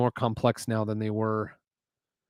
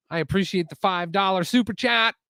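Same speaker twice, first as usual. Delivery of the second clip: higher, upbeat thanks at normal pace; excited thanks; 6 semitones higher than usual. Real, not acted. Streamer thanking a viewer for a donation, excited. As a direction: thank them brightly, running straight through.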